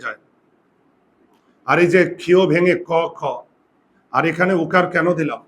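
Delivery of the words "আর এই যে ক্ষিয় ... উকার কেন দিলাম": ordinary delivery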